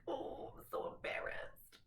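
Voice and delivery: hushed, drawn-out mock embarrassment; soft, slow playful cringe; embarrassed. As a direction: act out being mortified, slow and under your breath. Retelling an embarrassed character's reaction.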